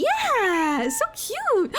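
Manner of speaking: very high cooing voice. Real, not acted. Streamer cooing over a microscopic shark, very high. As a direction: coo over something tiny.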